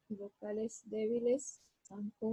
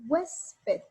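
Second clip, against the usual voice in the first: "huésped" with a loud, raised "hués"; stress on HUÉS; 6 semitones higher, 6 dB louder than usual. Shown as a demonstration. Teacher showing students the tonic syllable, "huésped" hit hard on "hués". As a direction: punch "huésped" on its first syllable, HUÉS-ped. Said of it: "aquí yo elevo la voz en hues".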